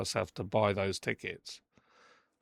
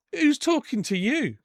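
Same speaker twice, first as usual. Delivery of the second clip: high, slow, louder challenge; angry retort; 14 semitones higher, 5 dB louder, slower than usual. Retold or imitated. Snapping at lifeguard who told her take the warning, angry. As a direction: re-enact a stretched-out, indignant comeback. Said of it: "by this time I'm getting angry".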